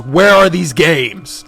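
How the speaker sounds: slow, loud interrogating yell; screamed demand. A narrator's reading. Batman interrogating the salesman he lifts up, screaming. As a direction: stretch the demand into a loud shout. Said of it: "lifts him up and screams".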